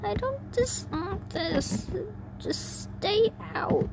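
high-pitched desperate plea; tearful pleading. Acted. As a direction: beg through tears in a high voice. Acted sobbing character begging voices to leave.

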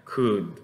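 "could" with short u and a D ending; careful model of "could"; final D demo. Shown as a demonstration. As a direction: close "could" on a clear D. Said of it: "con una D al final".

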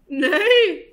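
high, loud falling whinny; horse's neigh. A narrator's reading. Reader voices the horse in the story, loud neigh. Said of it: "said the horse".

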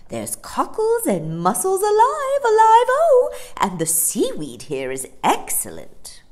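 high, loud street-seller cry; Amelia the hospitable dolphin. Acted. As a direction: sing out the menu with relish.

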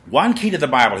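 loud, weighty mock-solemn quote; quoting the preacher. To his audience, reading the preacher's quote loud and solemn. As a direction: lean into it like a pulpit. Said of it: "he says".

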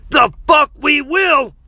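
very high, loud shout; defiant yell. A narrator's reading. Aaron refuses to leave Jeff behind, yelling. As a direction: yell back in flat-out refusal. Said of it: "Aaron yelled".

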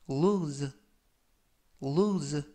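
"lose" said with a long u and a buzzing final z, falling; careful pronunciation demo. Shown as a demonstration. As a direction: model "lose" and stress the z ending. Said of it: "라고 발음하시면 되겠고요". ("lose" 끝소리를 즈로 발음 시범)

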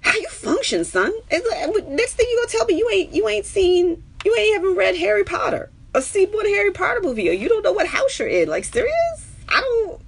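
high, loud disbelieving rant; can't believe what you just heard; incredulous. Real, not acted. Streamer reacting to a viewer who never saw Star Wars, incredulous.

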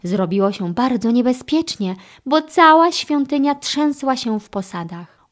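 ordinary delivery, nothing marked

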